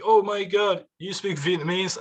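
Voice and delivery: amazed local. Retold or imitated. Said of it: "They're like".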